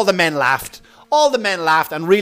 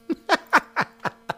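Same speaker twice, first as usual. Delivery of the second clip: short high laugh; 11 semitones higher, 4 dB quieter than usual. Real, not acted. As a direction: burst into a laugh. Laughs after joke.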